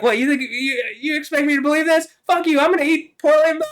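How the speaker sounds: very high, loud squeaky cartoon yell; Cartman voice. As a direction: scoff and yell like a bratty cartoon kid. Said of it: "And, and Cartman's like".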